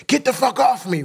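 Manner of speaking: high-pitched reenacted outburst; frantic protest. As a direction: fight them off in a retold, high-voiced protest. Acting himself fighting off hospital staff.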